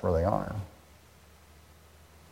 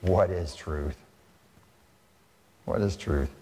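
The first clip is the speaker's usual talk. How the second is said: dismissive Pilate; 5 semitones lower, 3 dB quieter than usual. Retold or imitated. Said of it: "Pilate says famously".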